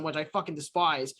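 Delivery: ordinary talk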